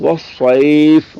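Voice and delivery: والصیف very slow with a thick, heavy saad; demonstrating the heavy saad slowly. Shown as a demonstration. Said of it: "صاد جو ہے یہ پر ہے".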